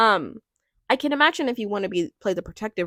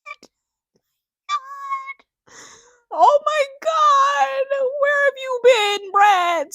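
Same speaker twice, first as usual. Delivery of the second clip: much higher, drawn-out, near-crying cry of joy; emotional squeal; 6 dB louder, slower than usual. Real, not acted. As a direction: let it burst out like you're overwhelmed and about to cry. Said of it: "this honestly made me emotional".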